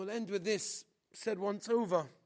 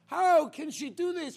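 much louder, higher outraged cry; outraged shout; 7 semitones higher, 11 dB louder than usual. Retold or imitated. Soldiers raging at the girl's refusal, shouting. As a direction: explode with disbelief.

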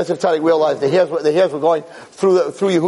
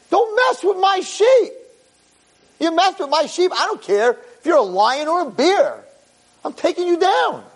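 fierce defiance; 11 semitones higher than usual. Acted. David warns Shaul's enemy defiantly.

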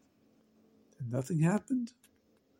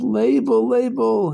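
calling out joyfully; 7 semitones higher, 5 dB louder, slower than usual. Acted. Father runs to thank carpenter.

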